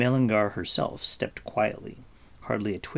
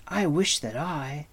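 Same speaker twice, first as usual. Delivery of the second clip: higher, eager wish; excited cry; 6 semitones higher than usual. A narrator's reading. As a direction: begin a wish with mild fervor. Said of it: "Taran cried".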